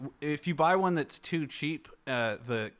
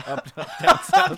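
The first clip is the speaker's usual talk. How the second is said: laughing; 9 dB louder than usual. Real, not acted. Laughing southern phrase.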